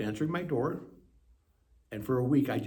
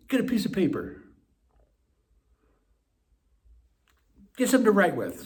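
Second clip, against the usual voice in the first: high, firm sponsor's order; imitating an insistent sponsor; acted command; 8 semitones higher, 5 dB louder than usual. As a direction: take charge and give instructions, pitched up. Sponsor orders him about.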